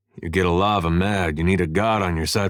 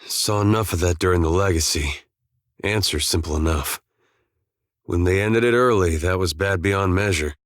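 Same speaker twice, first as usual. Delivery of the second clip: normal talking voice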